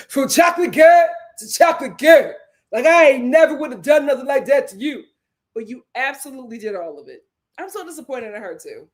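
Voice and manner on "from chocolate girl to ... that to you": mock Coco voice. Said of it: "Kimmy said".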